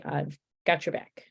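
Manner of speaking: ordinary delivery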